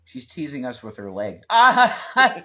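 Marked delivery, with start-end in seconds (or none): 1.5-2.4 high, loud "ah" shriek; loud laughing shriek. Real, not acted. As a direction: burst out with a high laughing cry. Reacting to teasing on stream.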